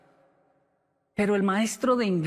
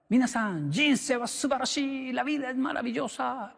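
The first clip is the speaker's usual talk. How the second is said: high, exuberant crazy teacher cry; teacher shouting; 7 semitones higher than usual. Retold or imitated. Teacher on his desk crying to the class, high exuberant shout. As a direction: belt it out like a wild teacher jumping on his desk. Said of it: "y gritaba".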